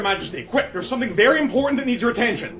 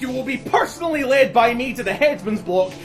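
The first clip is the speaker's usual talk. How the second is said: gleeful menace; 5 semitones higher than usual. Acted. Monarch pronounces execution.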